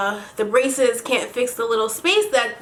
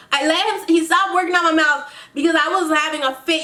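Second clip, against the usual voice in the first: ordinary talk, nothing marked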